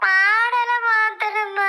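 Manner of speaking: very slow, drawn-out wail; wailing, indignant echo; theatrical bawling. Acted. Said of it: "நீ அழுகுற மாடலை".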